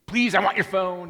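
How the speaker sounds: mock child, grudgingly polite; little kid, please shoved in front; child adding please.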